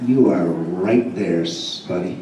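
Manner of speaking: low, slow, soft threat from a cop; menacing cop. Retold or imitated. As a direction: lean in and warn him slowly, down low. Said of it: "this dude just looked at me like".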